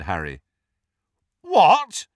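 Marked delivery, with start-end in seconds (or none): 1.4-2.1 loud, high yelp of disbelief. A narrator's reading. Friend reacting to Harry refusing the wand, loud. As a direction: burst out loudly, pitched way up. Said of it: "said Ron loudly".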